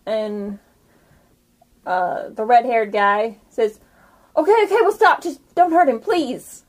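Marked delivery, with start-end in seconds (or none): 4.4-6.6 higher, hurried surrender; panicked pleading. Acted. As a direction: plead for a friend's life. Red-haired captive gives in, pleading.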